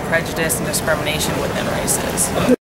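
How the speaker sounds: ordinary talk, nothing marked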